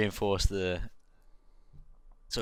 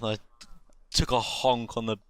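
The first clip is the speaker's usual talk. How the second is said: ordinary delivery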